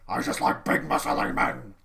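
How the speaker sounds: loud, half-voiced ranting confession; Vince ranting. Vince to Stephanie, loud cranky rant. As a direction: blurt it out like a cranky old boss.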